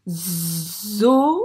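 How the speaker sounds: loud, long buzzing z into zo, then o; stretched z, syllables held apart; sounding out. Shown as a demonstration. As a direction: sound out zo-zo-o slowly as for a new reader. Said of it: "On corrige".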